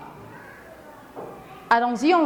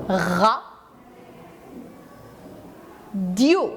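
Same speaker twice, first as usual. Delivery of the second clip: Ra and Dio split apart with a long pause between; teacher spelling out syllables for young pupils; 3 semitones lower than usual. Shown as a demonstration. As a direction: tap out Ra, Dio as two separate beats. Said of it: "Regardez avec moi".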